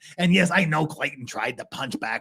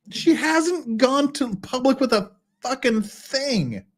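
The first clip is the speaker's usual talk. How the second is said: exasperated; 12 semitones higher, slower than usual. Real, not acted. Exasperated slow retort.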